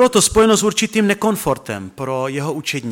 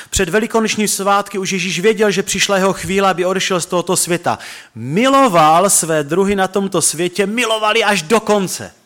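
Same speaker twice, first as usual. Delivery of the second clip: higher, emphatic preached quote of the verse; preaching the verse; 5 semitones higher than usual. Acted. Pastor pressing the verse on his listeners, fervent preaching. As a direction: lift the words up as the point of the sermon.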